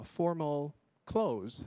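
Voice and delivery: CLOTHES as one slow syllable; "formal clothes" with no extra ending. Shown as a demonstration. Teacher modelling the correct word, one-syllable "clothes". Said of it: "就好了".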